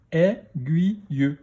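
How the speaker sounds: slow "Aiguille", split into è, gui, ye; "Aiguille" in three separate beats; syllable by syllable. Shown as a demonstration. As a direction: sound out "Aiguille" with a final ye. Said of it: "dans quelle syllabe".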